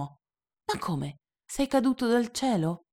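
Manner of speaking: put-on boy's question rising high; little prince amazed, voice jumping up; surprised little prince; astonished child.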